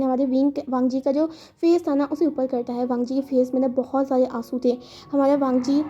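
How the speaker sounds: ordinary delivery, nothing marked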